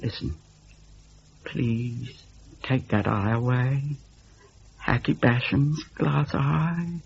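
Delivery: soft, slow and deliberate; a frightened child choosing each word softly; careful, quiet. A narrator's reading. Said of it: "he said carefully".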